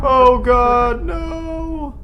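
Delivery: loud cry climbing into a high wail; panicked scream. Real, not acted. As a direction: scream it, stretching out the last word. Panicking as enemy attacks in game.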